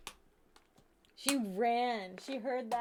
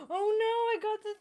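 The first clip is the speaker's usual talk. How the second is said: alarmed cry; 10 semitones higher, 7 dB louder than usual. Real, not acted. Glasses stuck in the Gundam.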